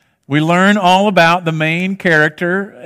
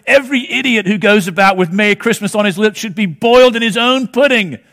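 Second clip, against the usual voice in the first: terrible put-on British accent; hammed-up British accent, a miser ranting; Scrooge; 3 semitones higher than usual. Miser answering his nephew's Merry Christmas, bad British accent. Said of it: "that was a terrible British accent".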